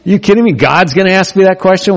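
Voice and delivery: high, disbelieving what-are-you-kidding-me; mock outrage at a silly question; Mary hearing the question in disbelief; incredulous.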